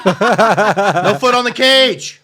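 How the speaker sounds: slow, loud, high ref call; hammed-up UFC referee. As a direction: drag it out like a ring announcer. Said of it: "sounds like a U F C referee".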